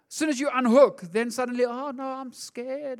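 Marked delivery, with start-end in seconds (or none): 1.6-3.0 soft, slow little whimper; mock scared. Preacher voicing people once God lets go, mock frightened. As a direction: shrink back like the cowering dog. Unhooked dog, then people panic.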